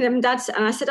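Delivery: ordinary talk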